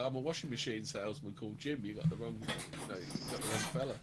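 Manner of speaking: a lightly voiced quiet, low bloke; Keith Fast impression. Quoting a film bit to the co-host, softly put on. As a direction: play it low and innocent, a hint of a salesman. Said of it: "the Keith Fast thing".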